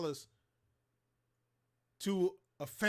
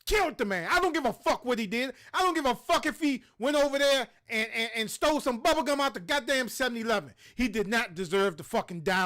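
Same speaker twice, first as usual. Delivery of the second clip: loud, raised, furious tirade; angry rant; 5 semitones higher, 6 dB louder than usual. Real, not acted. Ranting at viewers about the killed man, loud and furious. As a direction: hammer each line in real outrage.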